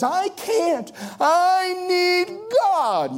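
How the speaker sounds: high, slow, drawn-out plea; desperate cry. Acted. Jabez admitting weakness to God, desperate high plea. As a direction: cry out as if at the end of yourself.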